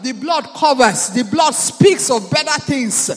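loud declaring prayer over music; fervent prayer. Acted. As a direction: lead the church in loud thanksgiving.

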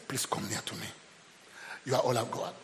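low, hushed request; soft plea. Acted. Joseph asking his brothers to come near, soft plea.

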